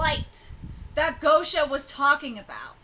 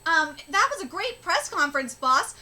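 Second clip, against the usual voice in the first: ordinary talk, nothing marked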